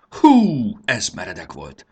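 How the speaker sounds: high, loud "hú", then easing down; big exclamation of relief; puffing relief. A narrator's reading. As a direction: blow out the tension after a scare. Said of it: "fújtatott Fanni".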